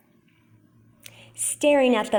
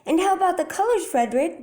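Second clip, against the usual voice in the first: anxious; 8 semitones higher, faster than usual. A narrator's reading. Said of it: "they asked anxiously".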